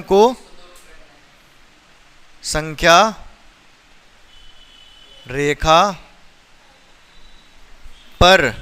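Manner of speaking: word by word, long pauses between; slow dictation. Real, not acted. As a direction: dictate it slowly for a class to copy. Writes question out word by word.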